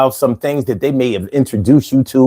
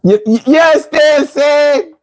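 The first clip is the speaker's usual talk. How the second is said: high-pitched, tickled stammer; amused; 12 semitones higher, much slower than usual. Real, not acted. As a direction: still giddy from laughing. Cracking up reading comments.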